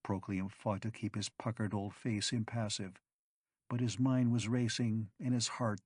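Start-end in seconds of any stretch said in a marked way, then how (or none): none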